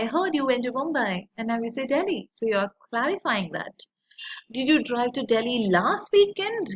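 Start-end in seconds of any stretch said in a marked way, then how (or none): 4.5-6.7 LAST punched in "last weekend"; stress on LAST WEEKEND. Shown as a demonstration. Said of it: "stressing at different places".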